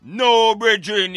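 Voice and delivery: normal talking voice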